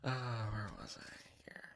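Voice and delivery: unvoiced whisper, losing the place; whispered aside. Real, not acted. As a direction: quietly whisper to yourself. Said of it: "my roommate might be watching".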